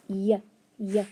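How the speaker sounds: "y" as a short, separate "yuh", twice; letter sound Y. Shown as a demonstration. Teacher quizzing pupils on spelling sounds, isolated letter sound. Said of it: "if I make the y".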